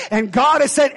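ordinary talk, nothing marked

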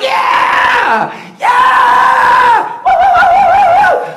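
high, loud joyful whooping; excited whoops. Retold or imitated. Pastor reenacting greeting his kids at the airport, whooping. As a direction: cheer like you're hugging family at arrivals.

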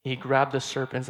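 usual speaking voice

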